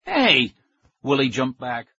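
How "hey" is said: high, loud startled cry; higher, louder outraged shout; indignant yelp. A narrator's reading. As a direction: yelp as mud hits him. Bully smeared with mud.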